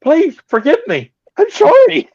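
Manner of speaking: high, loud panicky begging; mock pleading. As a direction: beg for mercy in hammed-up terror. Begging the giant, retold.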